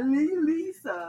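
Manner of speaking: put-on slow, drawn-out Tommy Wiseau accent on Lisa. Friend correcting the name in a Tommy Wiseau accent. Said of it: "Tommy Wiseau accent".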